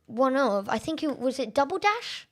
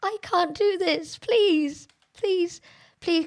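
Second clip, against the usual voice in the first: high-pitched begging; despairing plea; 7 semitones higher, slower than usual. Real, not acted. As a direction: plead as if about to give up. Begging while losing badly.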